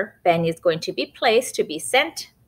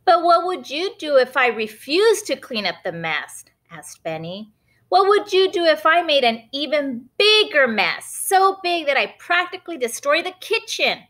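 Benny's voice; 9 semitones higher than usual. Acted. Boy Benny testing his mom, cheeky and high. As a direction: push her with a teasing question.

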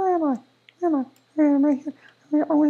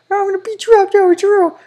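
louder mock battle cry at the enemy; pretend angry shout; 4 semitones higher, 8 dB louder than usual. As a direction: yell the threat like a cartoon villain. Bendy attacks Joey Drew.

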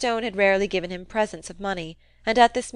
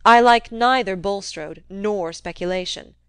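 sulky; 7 dB louder, slower than usual. Acted. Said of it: "He spoke rather sulkily".

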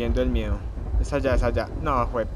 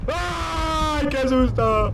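startled shout; 12 semitones higher, much slower than usual. Real, not acted. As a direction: yelp at the jump scare. Grita al asustarse. (grito de sobresalto)

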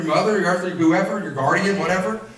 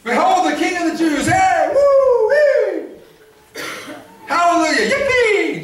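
much higher, shouted whoops and laughter; wild crowd cheering; 8 semitones higher, 3 dB louder, much slower than usual. Acted. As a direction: cheer like a delirious crowd at a parade.

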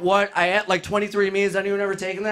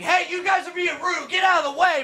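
intended confrontation; 6 semitones higher than usual. Acted. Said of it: "in my head, I wanted to be like".

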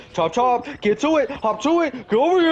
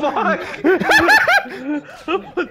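slow, stretched laughing shout; 9 semitones higher, 4 dB louder, much slower than usual. Real, not acted.

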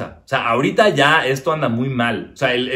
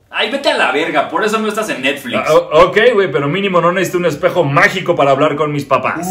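snippy puppet voice, pitched up; puppet retort; 5 semitones higher, 4 dB louder than usual. Acted. Puppet snapping back at the comedian, pitched up. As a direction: fire back like an insulted puppet.

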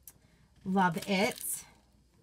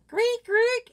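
creaking sign noise; 11 semitones higher, 6 dB louder than usual. Shown as a demonstration. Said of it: "It creaks when it swings".

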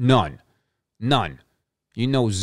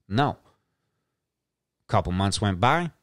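ordinary talk, nothing marked

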